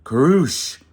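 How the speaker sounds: very loud crashing snore noise; a princess snoring mid-exit. Acted. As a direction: blast the snore out. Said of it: "Mouse heard".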